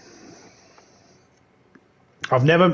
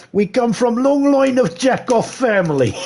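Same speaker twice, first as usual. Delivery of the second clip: much higher, louder mock Russian accent; bad Russian accent; 12 semitones higher, 4 dB louder than usual. Streamer pushes the fake Russian voice up high. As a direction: squeak out a cartoon Russian brag. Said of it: "that very, very bad accent".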